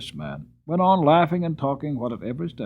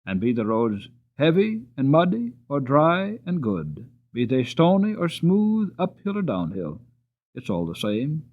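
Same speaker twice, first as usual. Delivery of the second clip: ordinary delivery, nothing marked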